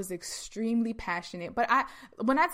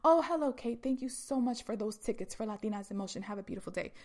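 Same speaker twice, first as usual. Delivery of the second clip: put-on polite restraint, dropping softer to the end; politically correct; 5 dB quieter, faster than usual. Speaker pretending to thank Kate for tickets, stiffly correct. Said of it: "trying to be politically correct".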